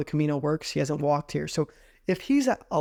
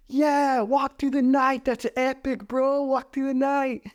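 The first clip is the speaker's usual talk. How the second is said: much higher, excited bro cheer; hyped bro; 9 semitones higher, slower than usual. Retold or imitated. Spanish guys cheer them to walk at night, high hyped bro voice.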